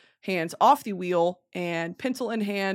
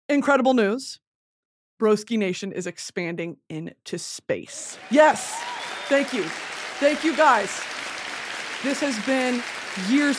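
formal speech; 3 semitones higher than usual. Pretend. Mock leader speech to crowd.

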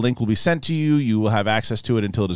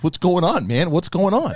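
curious friend; 9 semitones higher, 5 dB louder than usual. Retold or imitated. Voicing friend James in story.

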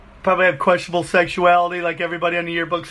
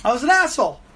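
mock older self; 7 semitones higher, faster than usual. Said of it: "read that and go".

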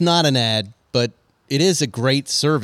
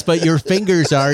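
ordinary delivery

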